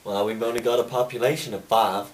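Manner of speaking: mock Southern accent, rising; suspicious Southern barkeep. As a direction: warn him off the devil's contraption. Cowboy bartender role.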